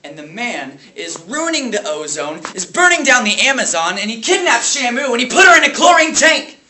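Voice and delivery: Dewey Finn. Acted. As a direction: rant building to a high, loud yell. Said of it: "lash out his rage at the small private school".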